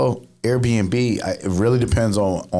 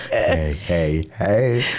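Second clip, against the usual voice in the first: Fat Albert voice; much slower than usual. Retold or imitated. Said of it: "That wasn't even a Fat Albert".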